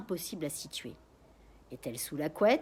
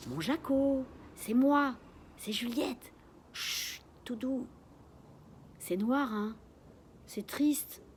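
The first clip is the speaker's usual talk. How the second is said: higher, soothing flight-attendant tone; gentle patient voice; 7 semitones higher, slower than usual. A narrator's reading. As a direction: coax the parrot calmly and sweetly. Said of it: "une voix très douce et très patiente".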